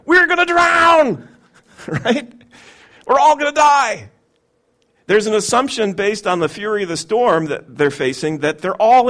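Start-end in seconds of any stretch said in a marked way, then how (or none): 0.1-4.1 high, loud panicked cry; panicked shout. Acted. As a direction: yell like you're about to drown. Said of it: "And then fear".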